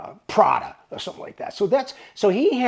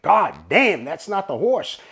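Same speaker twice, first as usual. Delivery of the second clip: loud, punched swearing; retold alarmed outburst; 3 semitones higher, 6 dB louder, slower than usual. Clerk hears the wrong horses being called, retold alarmed outburst. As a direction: re-enact an exasperated outburst.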